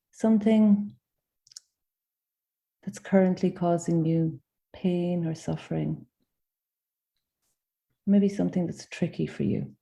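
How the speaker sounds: gentle guided meditation with long pauses; unhurried invitations, each left to settle in silence. Acted. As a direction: guide them inward, phrase by phrase.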